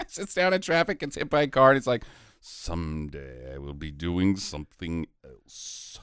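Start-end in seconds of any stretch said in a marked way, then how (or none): 2.4-6.0 low, slow and glum; weary resigned. Retold or imitated. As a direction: sigh it out like a defeated actor. Stunt baby voiced wearily.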